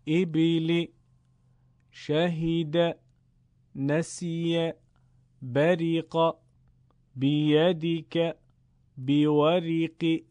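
measured Arabic words, one at a time; Arabic reading drill. Shown as a demonstration. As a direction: recite each word clearly for pupils to repeat.

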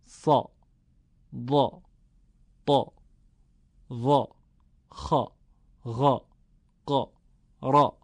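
each syllable from «صا» to «را» short, thick and full; isolated emphatic Arabic syllables, «صا» through «را»; heavy fatha. Shown as a demonstration. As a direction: voice «صا، ضا، طا» with a dark, voluminous vowel. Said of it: "فتحه ها همه درشت بود".

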